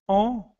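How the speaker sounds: "En" nasal, boca aberta; en nasal francês. Shown as a demonstration. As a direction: diga "En" bem nasal. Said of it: "Olha a diferença de som aí". ("En" nasal, como no francês)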